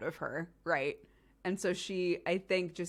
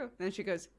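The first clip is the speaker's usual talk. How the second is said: ordinary talk, nothing marked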